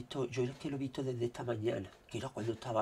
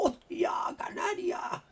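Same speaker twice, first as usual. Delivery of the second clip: slow, much higher startled exclamation; surprised shout; 3 dB louder, slower than usual. Real, not acted. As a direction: blurt it out in shock.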